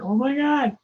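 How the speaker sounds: high, astonished gasp; amazed colleagues. Retold or imitated. Crew hearing the made-up Afghani words, astonished. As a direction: react in mock amazement. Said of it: "They went".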